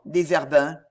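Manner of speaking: plain reading voice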